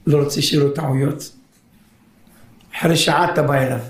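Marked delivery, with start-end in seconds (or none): none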